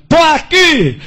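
loud, high answering yell; shouting back. Retold or imitated. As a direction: call out from the back of the cell block. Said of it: "Eu gritei lá no fundo".

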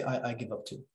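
quiet mock surrender; deadpan give-up. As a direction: mirror their despair quietly to shock them. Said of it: "sometimes I will shock them".

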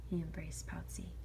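ordinary delivery

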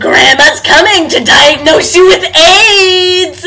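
slow, loud wailing taunt; hammed-up granny yell into a laugh; shouted granny taunt. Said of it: "That laugh is actually".

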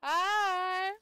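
loud, high cheerful greeting; excited shout hello. Real, not acted. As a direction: call hi out brightly.

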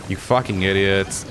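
usual speaking voice

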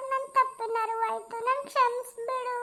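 high, pleading, on the edge of tears; tearful remorse. Acted. Mother-in-law begs Pooja's forgiveness, voice high and tearful. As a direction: beg forgiveness through rising tears.

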